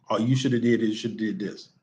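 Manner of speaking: mild mock scolding of the athlete; lightly voiced critical parent listing mistakes; judgmental parent. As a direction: play the nagging parent on the car ride home. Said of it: "Don't tell them what they did wrong".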